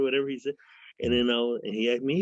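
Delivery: usual speaking voice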